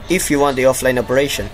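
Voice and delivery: ordinary talk, nothing marked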